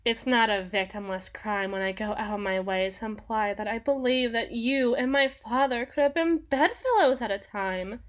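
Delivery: usual speaking voice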